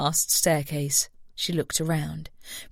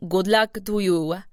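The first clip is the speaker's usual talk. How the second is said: put-on Italian accent; 3 semitones higher, 3 dB louder than usual. A narrator's reading. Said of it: "she said in a heavy accent".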